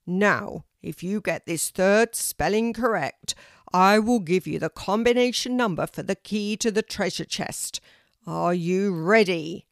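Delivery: loud, grand quizmaster tree; booming tree teacher. A narrator's reading. Oak tree offers the combination to Kelty, booming. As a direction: boom the promise out with weight. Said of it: "said a deep, loud voice".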